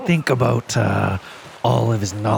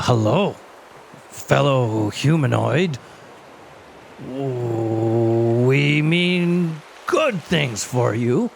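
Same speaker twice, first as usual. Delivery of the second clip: very slow; 3 semitones higher, slower than usual. Acted. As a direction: draw out each word very slowly. Player's slow awkward greeting.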